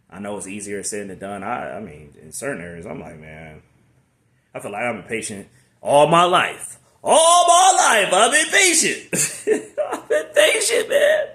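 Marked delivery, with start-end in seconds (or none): 3.2-9.7 high, loud, drawn-out complaint; exasperated rant. Real, not acted. Venting frustration, laughing. 9.9-11.3 high, loud laughing wail; half laugh, half sob; laughing cry. Real, not acted.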